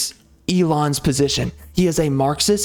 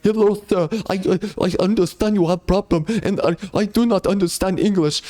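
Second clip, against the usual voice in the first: a hint of an Indian call-center agent; mock Indian accent; 3 semitones higher than usual. Caller mocking the Indian support agent with a mild Indian accent. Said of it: "They speak this broken English".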